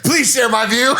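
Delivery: high, laughing mock appeal; mock pleading politician. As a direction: beg comically for agreement. Acts politician recruiting fighters.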